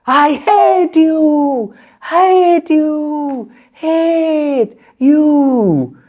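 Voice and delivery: slow, high, drawn-out echoing call; valley echo. Acted. The valley echoing back the man's words, slow echo. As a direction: let each repeat ring out like an echo off the hills. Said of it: "the valley responded back".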